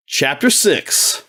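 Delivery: very slow, grand chapter title; mock solemn title reading; dramatic announcement.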